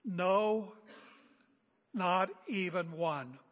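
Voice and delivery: slow, heavy stress on NOT; emphatic. Real, not acted. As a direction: hammer every word of not even one. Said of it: "you want to be emphatic".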